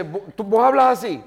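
high, loud disbelieving question; mock incredulous professor. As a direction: challenge the student in shock.

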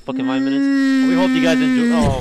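frustrated outburst. Real, not acted. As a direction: blow up at the bleeps. Venting about bleeped episode.

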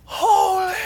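high, sustained loud cry; long ringing shout of praise; strong inflection. Shown as a demonstration. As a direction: scream it out like a seraph. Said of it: "there's a strong inflection of".

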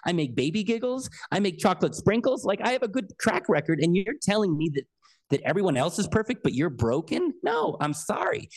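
high-pitched, indignant universe listing its credits; incredulous, offended creator with questioning lilts; affronted God. Retold or imitated. God answering someone who feels not good enough, affronted. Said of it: "the universe and God is like".

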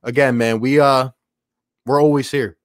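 ordinary talk

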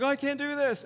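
imitating a panicked student, high and loud; freaking out. College kid melting down on a backpacking trip, freaking out. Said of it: "college kids are freaking out".

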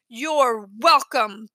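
very slow, drawn-out reply; mildly sarcastic. A narrator's reading. Daniel answering sarcastic thanks, pointedly slow and smug. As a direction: drag out each word smugly.

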